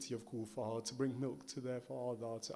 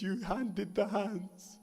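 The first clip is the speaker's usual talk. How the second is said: louder, impassioned mourning recitation over music; full-force elegy preaching, voice raised in sorrow; lamenting majlis recitation; 7 semitones higher, 20 dB louder than usual. Acted. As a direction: pour grief into every line as you recite the masaib. Climax of masaib, Ali's charge to Abbas.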